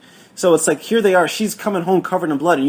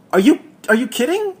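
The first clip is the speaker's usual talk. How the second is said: mock disbelief; 14 semitones higher, 5 dB louder than usual. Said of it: "I was like".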